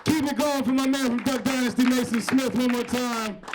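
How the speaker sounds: loud, high-pitched MC shout; rallying the crowd. Real, not acted. MC calling for applause for a comic, loud and rallying. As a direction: whip up applause at full voice. Host rallies applause after the act.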